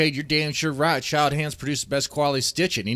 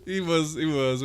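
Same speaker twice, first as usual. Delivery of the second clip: helpless laughter; 3 semitones higher than usual. Real, not acted. Laughing too hard to speak.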